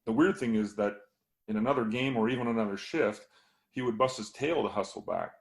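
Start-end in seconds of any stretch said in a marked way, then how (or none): none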